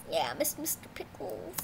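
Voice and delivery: hushed remembering; whisper. Real, not acted. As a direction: whisper the name softly. Whispered teacher name.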